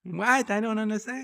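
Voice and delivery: high lisping put-on voice. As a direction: lisp it up high, puzzled. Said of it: "Stop doing that voice".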